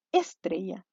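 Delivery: "Estrella" as ÉS-trella, weight on opening ES; stress on first syllable. Shown as a demonstration. Teacher asking if it sounds right, "Estrella" stressed on ES. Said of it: "¿Suena bien eso?".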